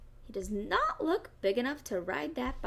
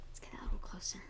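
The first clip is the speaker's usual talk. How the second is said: soft whisper, much quieter; 3 semitones lower, 8 dB quieter, much faster than usual. Real, not acted. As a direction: lean in and whisper it. Aside while showing the book.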